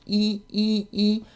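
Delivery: E with lips stretched wide at the corners; a tight smiling E; E vowel demo. Shown as a demonstration. Voice coach shows viewers a small-mouthed E vowel. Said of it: "E tends to have a small embouchure".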